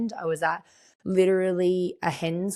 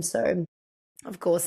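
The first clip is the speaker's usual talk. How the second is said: ordinary talk, nothing marked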